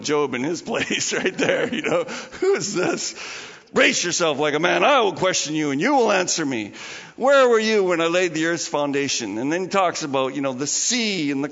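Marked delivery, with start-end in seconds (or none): 2.4-9.4 louder, commanding voice of God; God booming. Retold or imitated. God from the storm, booming louder at Job. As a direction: thunder it down from the storm. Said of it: "He says this".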